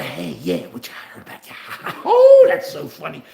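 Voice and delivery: mock old-timer gossip, whispering into a big laugh; imitating locker room gossip that breaks into a loud laugh; old geezers at the deli. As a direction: ham up the old guys trading crude jokes, cackling at the end. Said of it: "like guys in the back of the, of the deli".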